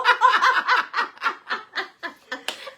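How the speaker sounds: shrieking laugh; high laughter. Real, not acted. Laughing at her own bingo story, high cackle. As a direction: let a high laugh burst out.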